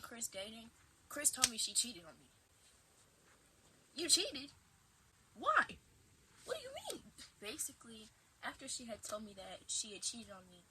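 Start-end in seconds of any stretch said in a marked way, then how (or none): 3.9-7.1 stunned, rising questions; shocked disbelief. Retold or imitated. To girlfriend after her confession, shocked disbelief. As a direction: voice jumping higher and louder in disbelief.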